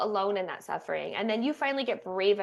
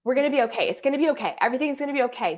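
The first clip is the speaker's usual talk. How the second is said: anxious partner soothing; 6 semitones higher, 6 dB louder, faster than usual. Retold or imitated. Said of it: "It's coming from this place of".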